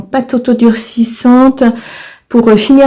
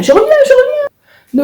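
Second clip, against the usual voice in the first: sing-song. Real, not acted. Leaving briefly, repeats phrase.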